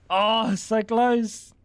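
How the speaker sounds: so close, stretched slowly in dismay; slow disappointed moan; drawn-out groan. Real, not acted. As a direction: let the near miss drag out. Near miss in game.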